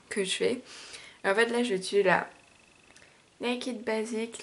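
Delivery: ordinary talk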